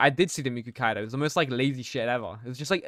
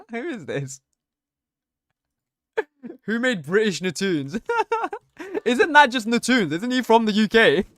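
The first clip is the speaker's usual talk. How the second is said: words pushed out through laughter; laughing; 8 semitones higher, 3 dB louder than usual. Real, not acted. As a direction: giggle through the question. Laughing at a chat username.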